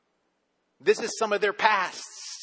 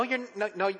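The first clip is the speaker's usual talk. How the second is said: ordinary talk, nothing marked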